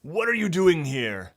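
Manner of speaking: loud, high demanding question; suspicious guard. Acted. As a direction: press them hard as the guard.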